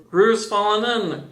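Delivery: alarmed cry. A narrator's reading. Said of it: "cried Rabbit".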